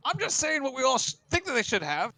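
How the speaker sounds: high whiny official, insisting; wheedling Prime Water. Acted. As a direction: coax the council into giving the boat. GM voices Prime Water pleading.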